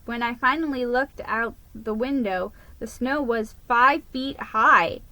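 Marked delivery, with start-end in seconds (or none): none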